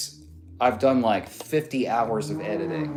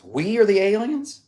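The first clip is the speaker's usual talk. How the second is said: higher, rising echo of the message; surprised question; 8 semitones higher, 4 dB louder than usual. Real, not acted. Streamer echoing a viewer's message back, surprised.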